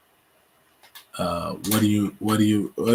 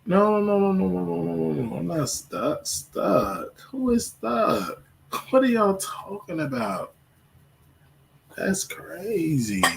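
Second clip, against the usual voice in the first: indignant denial; 9 semitones higher than usual. Real, not acted. Host tells viewers "I'm not stuck", indignant. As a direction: rattle off the no's, then stretch out the disbelief.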